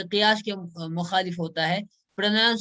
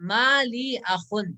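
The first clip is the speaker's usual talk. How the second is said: ما لی اخن said slowly, each word apart; slow model reading; 4 semitones higher, much slower than usual. Shown as a demonstration. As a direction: read ما لی اخن slowly for learners. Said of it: "میرا کوئی بھائی نہیں ہے".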